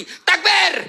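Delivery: loud high rallying cry; shout. Real, not acted. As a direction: call the crowd to shout back. Rallying the crowd. (teriakan takbir yang keras)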